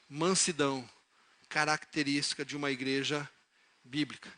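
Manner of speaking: soft phrases with long reflective pauses; solemn gentle preaching. Real, not acted. Preacher urging the church to think before offending, gentle and solemn.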